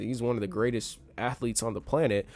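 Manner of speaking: ordinary talk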